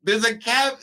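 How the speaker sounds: high, loud incredulous squeak; hammed-up falsetto disbelief; mock shocked question. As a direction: pretend to be floored by it. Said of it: "I was like".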